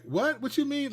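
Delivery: high, puzzled "what?"; confused husband. Retold or imitated. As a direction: play the baffled husband, pitch jumping up. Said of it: "you'd be like".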